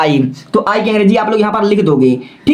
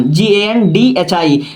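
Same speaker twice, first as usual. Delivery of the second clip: slow letter-by-letter dictation; spelling out; much slower than usual. Shown as a demonstration. Teacher spelling Gandhi for students, slow letter by letter. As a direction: give each letter its own beat.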